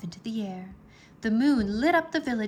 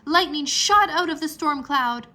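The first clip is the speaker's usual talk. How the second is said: plain reading voice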